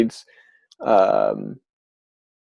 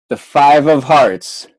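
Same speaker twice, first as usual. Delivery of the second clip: ordinary talk, nothing marked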